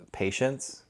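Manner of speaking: quiet questioning rise on "patients?", catching a slip; realizing "patients?" is the wrong word mid-sentence; puzzled self-check. Real, not acted. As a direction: stop and doubt the word "patients?" with a soft upward lift. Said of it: "Not patients".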